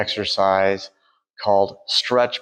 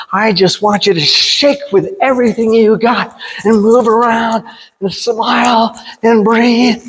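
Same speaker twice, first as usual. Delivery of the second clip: building to a joyful shout, much higher; excited; 11 semitones higher, 7 dB louder than usual. Acted. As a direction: pump them up, louder and louder. Hyping up shaking exercise.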